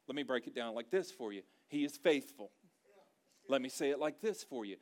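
ordinary delivery

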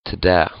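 Träd said as "tuh-dä"; Träd broken into T, D, Ä. Shown as a demonstration. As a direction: swap the R in Träd for a plain D. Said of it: "exchange the R for a D".

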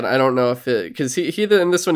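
ordinary talk, nothing marked

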